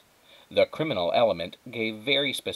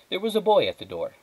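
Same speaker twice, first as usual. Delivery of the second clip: usual speaking voice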